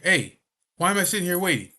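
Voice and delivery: shouting at the nurses in the waiting room; loud demand. Retold or imitated. A patient yelling at nurses, loud and impatient. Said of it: "loud as fuck in there".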